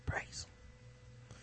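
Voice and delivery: hushed, voiceless mock devotion; mock-reverent whisper. Host playing along with caller's praise, mock-reverent whisper. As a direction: whisper it like a tiny prayer.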